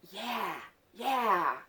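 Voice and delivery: loud retold angry yeah; pissed off. Child answering him while crying, angrily insistent. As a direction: throw each word out hard, falling. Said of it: "I'm pissed".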